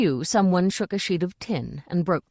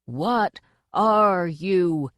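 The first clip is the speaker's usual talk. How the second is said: very slow, drawn-out bellow; contained drill-sergeant scream; much slower than usual. A narrator's reading. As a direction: stretch out each word, demanding. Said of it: "he screamed".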